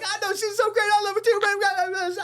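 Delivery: two men crying together, hammed up; mock tearful agreement. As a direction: gush back through pretend tears. Narrator's emotional reply retold.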